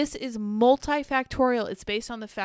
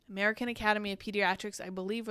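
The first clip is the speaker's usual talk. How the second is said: ordinary delivery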